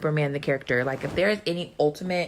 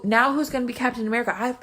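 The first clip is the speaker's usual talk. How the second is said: rising high and fast, getting carried away; real fan frenzy building; worked up; 9 semitones higher, faster than usual. Real, not acted. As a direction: spiral up into a fan panic. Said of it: "I need to calm down".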